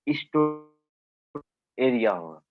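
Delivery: choppy dropping internet call; corrupted glitchy audio. Real, not acted. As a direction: voice cutting out, dragged and broken. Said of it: "sir, बीच में आपकी आवाज cut जा रही है।".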